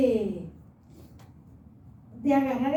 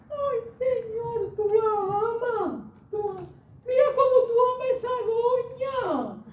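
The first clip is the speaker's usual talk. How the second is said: tearful prayer; 11 semitones higher, 3 dB louder than usual. Retold or imitated. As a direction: cry out to the Lord. (oración llorosa, aguda)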